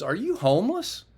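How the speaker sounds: surprised question. Retold or imitated. Officer asks.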